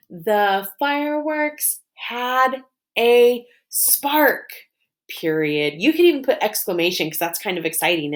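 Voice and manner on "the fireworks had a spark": "The, fireworks, had, a, spark" slowly, higher, each word separate. Shown as a demonstration. Teacher leading pupils through the sentence, "The fireworks had a spark" word by word. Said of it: "let's map it".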